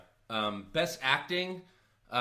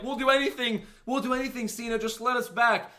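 higher, begging mock Triple H; pleading Triple H; 9 semitones higher than usual. Host voicing Triple H pleading with Cena for his job back. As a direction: beg Cena like a desperate boss. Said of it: "Triple H is like".

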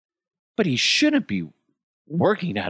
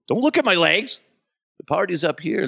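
a gym bro shouting to the crowd; mock bragging bodybuilder; 9 semitones higher than usual. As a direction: ham it up like a muscle guy on stage. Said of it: "always talking about how he works out".

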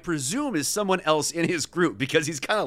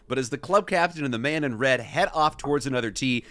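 plain reading voice

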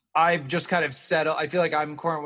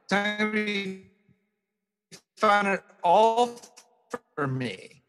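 choppy skipping audio; words breaking up over bad Wi-Fi; buffering stutter; 5 semitones higher, slower than usual. Real, not acted. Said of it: "how badly your audio is skipping".

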